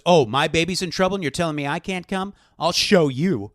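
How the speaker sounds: loud, acted outrage; indignant defiance. Father told he can't come to his baby, loudly defiant. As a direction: throw it back as a mock challenge.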